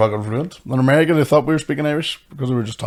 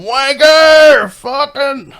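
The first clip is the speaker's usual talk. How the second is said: high, loud drawn-out yell of abuse; heckling shout; 9 dB louder, much slower than usual. Acted. Friends seeing his slowed act would yell, heckling shout. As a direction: holler it like a jeering mate. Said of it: "you'd be going".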